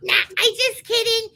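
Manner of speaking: very high, squeaky mascot voice, British act dropped; high put-on cartoon character voice; Bucky. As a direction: talk like a silly little puppet confessing a joke. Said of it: "That's how I talk".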